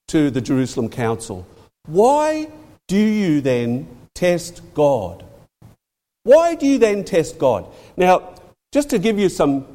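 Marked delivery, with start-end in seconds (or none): 1.9-5.2 very slow, word by word; deliberate, spaced-out challenge; slow, weighty challenge. Retold or imitated. Peter's challenge quoted, then repeated. 6.2-7.7 higher, run together without pauses; pointed challenge in one breath; pressing challenge. Retold or imitated. Same challenge repeated more forcefully.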